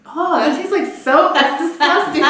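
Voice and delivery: put-on high, loud childish disgust; childhood self disgusted. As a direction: whine it like a grossed-out kid. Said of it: "I'm like".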